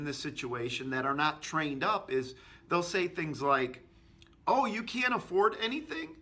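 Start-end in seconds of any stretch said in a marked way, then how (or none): 4.5-6.2 repeating it back. Shown as a demonstration. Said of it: "They'll repeat it back to 'em".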